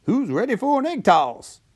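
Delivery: high, excited dad character. A narrator's reading. Dad invites everyone to a game, high playful call. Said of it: "asked the dad".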